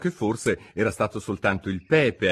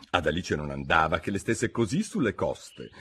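ordinary delivery, nothing marked

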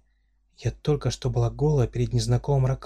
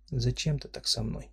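much softer, sorrowful question; sad; 8 dB quieter than usual. Acted. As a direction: ask it quietly, hurt. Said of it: "грустно спросила я".

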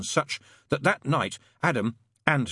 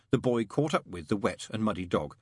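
ordinary delivery, nothing marked

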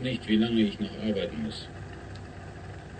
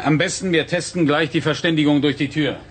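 loud, raised voice to carry through a door; calling through door; 4 semitones higher, 9 dB louder than usual. Acted. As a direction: project it so he hears you behind the door. Testing speech through a door.

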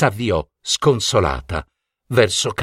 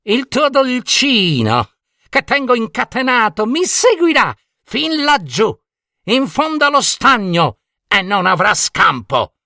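high-pitched wicked witch making threats; threatening witch; 10 semitones higher than usual. Acted. Trapped witch threatens the princess. (minaccia da strega, voce acuta)

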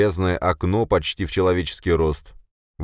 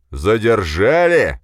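drawn-out, louder and higher outraged question; outraged yell; 5 semitones higher, 7 dB louder, much slower than usual. A narrator's reading. Boss yells at subordinate's excuse, outraged. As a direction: yell the word back in disbelief. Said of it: "завопил бегемот".

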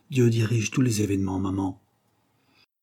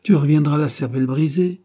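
laughing tease; 6 semitones higher, 6 dB louder than usual. A narrator's reading. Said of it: "dit Jane en riant".

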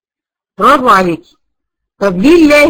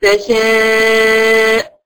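slow, melodic tajweed chant; Quran recitation; much slower than usual. Acted. Teacher reciting her favourite verse, melodic recitation. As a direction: draw out each word in measured recitation.

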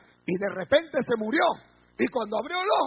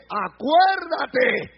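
loud, higher, stretched-out "Acuérdate"; drawn-out, raised-voice "Acuérdate"; emphatic reminder; 4 semitones higher, 6 dB louder, much slower than usual. Acted. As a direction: proclaim "Acuérdate" slowly and forcefully. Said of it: "Porque le dice".

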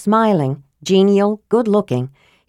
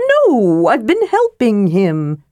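high, indignantly proud kender; 4 semitones higher, slower than usual. A narrator's reading. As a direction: protest proudly in a raised pitch. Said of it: "Tass said proudly".

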